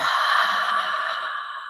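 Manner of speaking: slow audible breath out; long exhale. Shown as a demonstration. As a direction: breathe all the air out slowly. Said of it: "I invite you to exhale fully".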